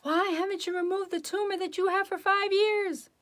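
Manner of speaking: high-pitched mock outburst of a doctor; storming in. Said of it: "he came in storming and he said".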